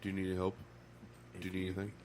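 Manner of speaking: a hint of a meek hovering manager; timid GM. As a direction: meekly offer help like a hovering boss. Mocking GM's timid offer.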